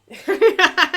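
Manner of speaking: loud, high-pitched laugh; real high cackling laugh. Real, not acted. Laughs at the dog.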